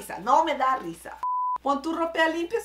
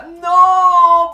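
sudden loud, high cry of disbelief; shocked shout; 6 semitones higher, 16 dB louder than usual. Real, not acted. Laughing at the video, a loud shocked no. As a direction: blurt it out in shock.

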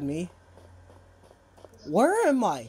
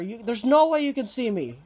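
ordinary delivery

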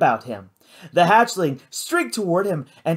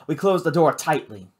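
ordinary delivery, nothing marked

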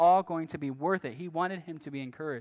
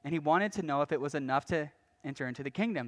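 usual speaking voice

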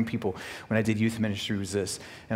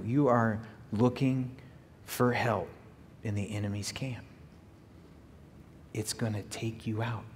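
soft earnest appeal; 9 dB quieter than usual. Real, not acted. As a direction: speak gently to those who are tempted. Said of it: "hearing my voice right now".

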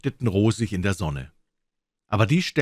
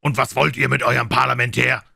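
loud, raised-pitch demand; challenging shout; shouted question; 6 semitones higher, 6 dB louder than usual. A narrator's reading. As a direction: call the question across to the enemy. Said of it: "und rief".

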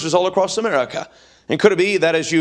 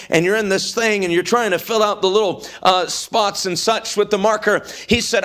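ordinary talk, nothing marked

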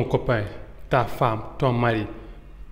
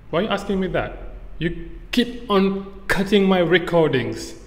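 high-pitched, acted irritated complaint; pissed off; 5 semitones higher than usual. Retelling a row over door slamming during recordings, pissed off. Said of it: "You're pissing me off right now".

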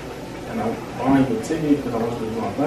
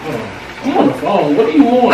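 louder, higher irritated bark; annoyed snap; 7 semitones higher, 5 dB louder than usual. Acted. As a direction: shut her out, you're busy. Snapping at partner interrupting call.

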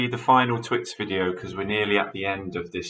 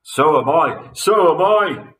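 high, loud wail of dismay; cried out; 6 semitones higher, 6 dB louder than usual. A narrator's reading. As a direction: wail along in alarm. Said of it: "cried Mister Twit".

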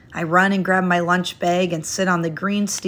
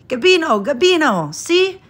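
higher, louder eager calling; excited yell; 10 semitones higher, 6 dB louder than usual. A narrator's reading. Landlady showing the painting to her canary, in an excited yell. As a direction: call out to the bird with delight. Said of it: "Missus Andasola yells".

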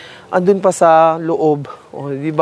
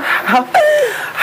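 laugh. Real, not acted. Unsure of plant's name.

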